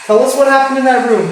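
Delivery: loud call into the room. Real, not acted. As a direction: call out to the spirit, loud and high. Calling out to spirits loudly.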